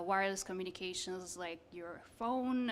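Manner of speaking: ordinary talk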